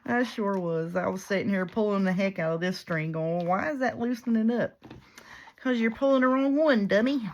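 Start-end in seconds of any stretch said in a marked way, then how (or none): 3.4-4.8 raised, higher-pitched self-mocking question; mock puzzlement. Herself pulling the wrong string, puzzled mock wail. 5.6-7.3 loud, high exasperated heckle; imagined viewer yelling. Retold or imitated. Viewer yelling at her over the wrong string, mock exasperated yell. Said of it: "somebody was yelling just loud as they could at me".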